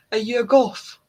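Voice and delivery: loud Scottish "goth" with a hard t; Scottish accent. Retold or imitated. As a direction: shout it in a broad Scottish accent. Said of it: "There's a T H on the end".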